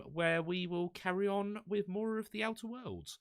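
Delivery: voice going high. Real, not acted. Streamer telling viewers what's next, voice going up high. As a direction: pitch climbs high through the sign-off. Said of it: "my voice went up so high there".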